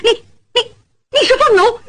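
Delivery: high stammering disbelief; mother stammering in shock; stunned mother. Acted. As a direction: stammer it, she can't believe it. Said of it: "吓得往后直躲".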